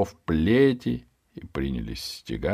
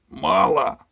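high, loud rising grumble; displeased boss; 12 semitones higher, 6 dB louder than usual. Acted. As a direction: sneer at the coins. Hunchback demands more money. (высоко и недовольно)

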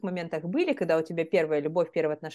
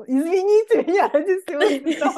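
laughing; 10 semitones higher, 8 dB louder than usual. Real, not acted. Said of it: "своей актёрской игрой".

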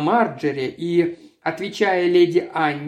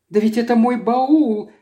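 higher, louder cry of surprise; startled exclamation; exclaiming; 7 semitones higher, 4 dB louder than usual. A narrator's reading. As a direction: blurt it out in shock. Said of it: "воскликнула она".

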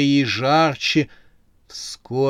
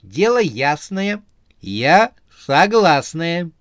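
squirrel voice; 5 semitones higher, 6 dB louder, slower than usual. A narrator's reading. Squirrel agrees to live together.

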